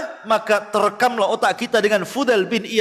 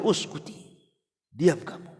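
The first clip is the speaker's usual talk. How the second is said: breathy whispered hush, much softer; hushed rebuke; 7 semitones lower, 9 dB quieter than usual. Retold or imitated. Fudail silencing his crying wife, whispered. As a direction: shush her sharply under your breath.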